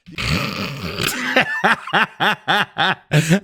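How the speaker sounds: a burst of genuine laughter rising high; amused laugh; real laugh. Real, not acted. Commentator laughs at the clip.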